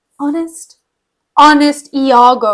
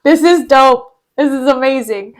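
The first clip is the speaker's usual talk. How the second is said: usual speaking voice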